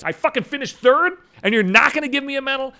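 high, loud outraged yelp; indignant outburst. Retold or imitated. Ranting about being denied a medal at the race, high and outraged. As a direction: blow up at the unfairness of it.

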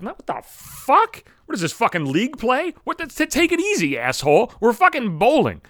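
high-pitched, incredulous rant; exasperated outburst. Acted. Telling the boyfriend it is only bowling, exasperated. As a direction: snap at him in high, disbelieving exasperation.

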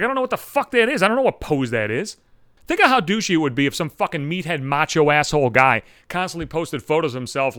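ordinary talk, nothing marked

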